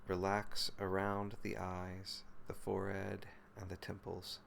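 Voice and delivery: calm, even meditation voice with long pauses; guided meditation. Real, not acted. Teacher leading listeners through body and breath, guided meditation. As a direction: guide them gently into the body, phrase by unhurried phrase.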